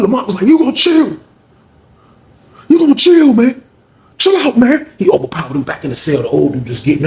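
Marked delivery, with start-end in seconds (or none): 0.5-4.9 high, insistent retold command; acted forceful order. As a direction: bear down on each order, pitched up. Lamont forces Sean to calm.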